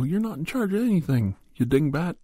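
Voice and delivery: high mock jeer from passing drivers. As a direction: tease her, voice pitched high. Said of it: "beep their horn and go".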